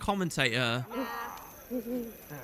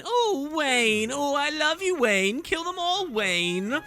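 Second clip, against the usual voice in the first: high swooning fangirl voice, much higher; lovestruck fangirl; 10 semitones higher, much slower than usual. Acted. Player voicing a lovesick fan swooning over Wayne.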